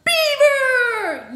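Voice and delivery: superhero-arrival cry; excited announcement. A narrator's reading. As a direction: build up, then bellow the last word. Announcing a hero's sudden arrival.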